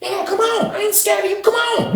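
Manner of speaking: very high, soft squeaky come on; squeaky taunt. Retold or imitated. BB taunting the dude to fight, very high squeaky voice. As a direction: tease him in a tiny high voice.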